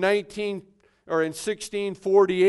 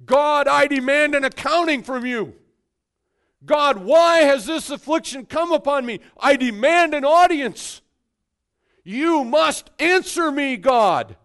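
higher, louder, insistent demand; demanding Job; 6 semitones higher, 7 dB louder than usual. Acted. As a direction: confront God as indignant Job. Job demands an answer from God.

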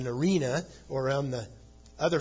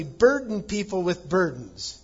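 "burden people with burdens" higher and louder; very emphatic; 6 semitones higher, 7 dB louder than usual. Retold or imitated. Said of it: "is very emphatic".

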